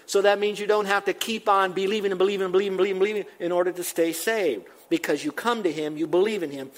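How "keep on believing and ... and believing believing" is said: fast, higher run-on repetition; endless believing run. Real, not acted. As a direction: rattle the repeats off without a break. Mimics endless effort of believing.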